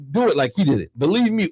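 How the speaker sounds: ordinary delivery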